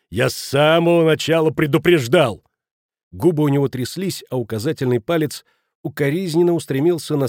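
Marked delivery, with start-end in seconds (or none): 0.1-2.4 higher, louder accusing shout; furious cry. A narrator's reading. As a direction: shout the reproach at all of them. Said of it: "закричал Шмидт". (яростный крик с упрёком)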